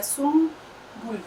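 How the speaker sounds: سنبل said slowly as سُمبل with an m before ب; drawn-out سنبل, نون sounding as میم; sumbul with m sound. Shown as a demonstration. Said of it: "جیسے ہم سنبل لکھتے ہیں". (سنبل کو سُمبل کی طرح آہستہ بولیں)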